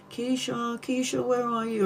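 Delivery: put-on soft high child's call; a small boy searching for his sister; little brother calling. Little brother hunting for Keisha, soft and high. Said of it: "hear her little brother going".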